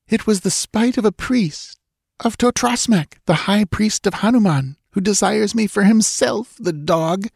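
put-on frightened young woman. A narrator's reading. Girl voiced in story.

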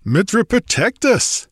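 high-pitched exclamation; exploding in disbelief. A narrator's reading. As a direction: burst out in mild astonishment. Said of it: "exploded Amalric".

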